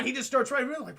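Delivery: ordinary delivery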